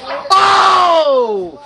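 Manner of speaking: long, high, loud "oh"; shocked shout. Real, not acted. Commentator watching a clothesline land, shocked shout. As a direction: cry out at a huge hit.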